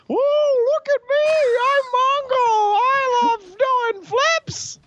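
very high, slow squeaky mocking voice. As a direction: puppet the corpse in a squeaky high voice to mock it. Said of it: "I pretend like ... one octave higher, for maximum mockery".

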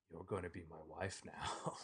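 mock kidnapper murmuring to his captive; creepy low voice. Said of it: "And you're like".

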